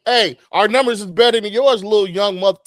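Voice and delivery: loud, high-pitched taunt; playful boasting. Pretend. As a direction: crow it at the doubters. Playful boast at podcast doubters.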